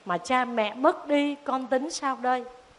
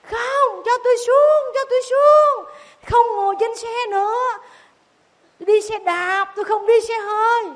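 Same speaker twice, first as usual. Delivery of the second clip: high, loud panicked crying plea; wailing child; 10 semitones higher, 6 dB louder than usual. Acted. Tí wailing to be let out of the car, high, loud and panicked. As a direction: shriek it like a terrified boy. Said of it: "Tí khóc ré lên".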